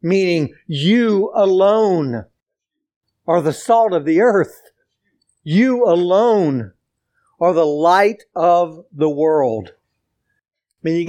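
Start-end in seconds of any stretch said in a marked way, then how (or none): 0.7-2.3 "you alone" slow, each word weighted; emphatic you alone. Shown as a demonstration. 5.4-6.7 "you alone" slow, each word weighted; emphatic you alone. Shown as a demonstration.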